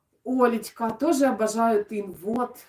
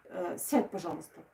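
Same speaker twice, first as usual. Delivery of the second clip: quiet aside; 4 semitones lower, 7 dB quieter than usual. Real, not acted. Hushed aside to her son.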